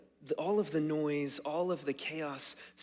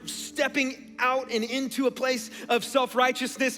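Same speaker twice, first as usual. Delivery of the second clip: loud, higher-pitched piling up of a list; forceful preaching; 9 semitones higher, 8 dB louder than usual. Real, not acted. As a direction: preach the noise and chaos with raised voice. Preaches with raised voice.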